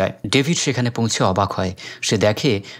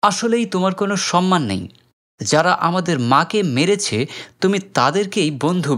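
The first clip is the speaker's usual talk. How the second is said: raised, accusing reproach to his brother; angry; 8 semitones higher than usual. Acted. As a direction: scold him, pitched high. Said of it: "রাগ করে বলে".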